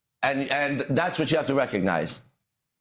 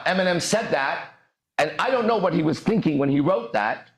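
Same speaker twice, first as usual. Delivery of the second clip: ordinary talk, nothing marked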